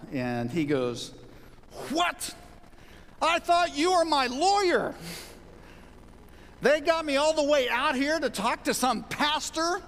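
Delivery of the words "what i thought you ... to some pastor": retold jailed man yelling in outrage; upset outburst. Prisoner to the pastor who isn't his lawyer, upset outburst. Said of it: "he was pretty upset".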